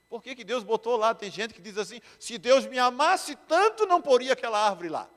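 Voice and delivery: high, loud pulpit argument; forceful, insistent sermon delivery; impassioned preaching. Real, not acted. Preaching at full force, voicing objection.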